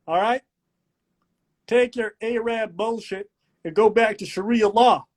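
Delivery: hammed-up Southern patriot lecturing; mock Southern rant. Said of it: "and I'm like".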